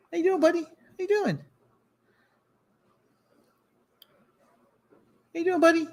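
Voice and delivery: pet talk. Real, not acted. Host greets his cat on camera, high cooing. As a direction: coo up high at the kitty.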